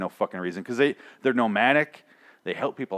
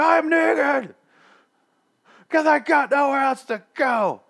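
high, strained hammed-up yell; mock rallying shout; 6 dB louder, slower than usual. Retelling the saviors' call and response, exaggerated high shout. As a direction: bellow it high, dragging out the words. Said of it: "That sort of speech".

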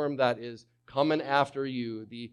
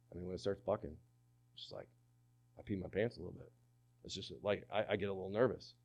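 much softer, sheepish confession; nervous cowboy; 5 semitones lower, 10 dB quieter, much faster than usual. Retold or imitated. Preacher imitating a rodeo cowboy admitting fear, soft and sheepish. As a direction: mumble it like an embarrassed cowboy. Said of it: "have you ever talked to a rodeo cowboy".

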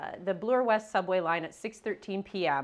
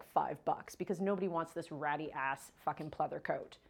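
ordinary talk

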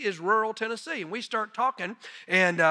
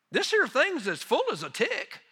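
rural Tennessee man; 7 semitones higher than usual. Retold or imitated. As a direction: quote him in a country Tennessee accent. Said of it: "exact quote of what he said".

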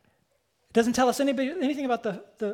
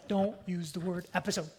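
a quiet note to self, half under the breath; soft private self-scolding; muttered self-reminder; 2 semitones lower, 8 dB quieter, faster than usual. Retold or imitated. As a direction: mutter it softly to yourself. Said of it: "I tell myself".

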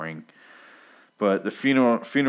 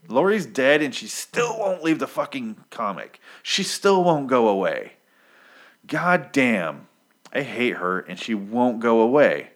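higher, louder, fed-up venting; exasperated rant; 5 semitones higher, 4 dB louder than usual. Real, not acted. To the viewers, annoyed that Lori keeps appearing in the comic: exasperated venting. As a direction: throw your hands up at the character. Ranting about a character who won't die.

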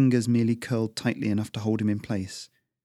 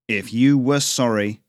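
mild angry growl, a bit louder; 2 semitones higher, 5 dB louder than usual. Acted. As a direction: bite the words out at the boy. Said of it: "the man growled".

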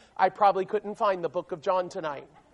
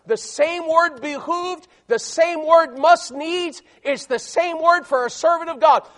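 higher, louder pulpit voice; forceful preaching; 7 semitones higher, 9 dB louder than usual. Real, not acted. Preacher tells servants there are no negotiations, forceful preaching. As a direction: press the point without letting up.

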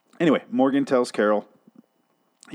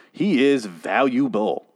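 ordinary delivery

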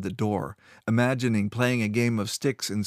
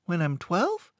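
put-on higher, curious child; young boy voice; 8 semitones higher than usual. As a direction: ask like an eager boy. Boy asks.

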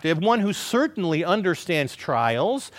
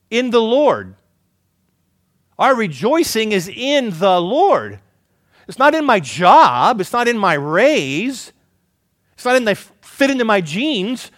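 preaching build; 4 semitones higher, 6 dB louder than usual. Acted. Preaching at full force.

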